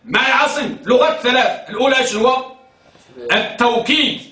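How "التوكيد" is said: التوكيد with a w glide, taw-keed; with waw. Shown as a demonstration. Teacher recaps the first of three variants, waw pronunciation. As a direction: sound the waw clearly in التوكيد. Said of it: "بالواو".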